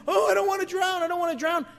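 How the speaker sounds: high shouted plea not to drown; desperate cry. Retold or imitated. Jonah in the sea begging God, high panicked shout. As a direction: yell it out from the water in panic. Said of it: "he's asking".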